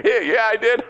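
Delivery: high-pitched answer breaking into laughter; helpless laughter through the words; acted laughing reply. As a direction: play someone too amused to care. Rob only laughs at bad news.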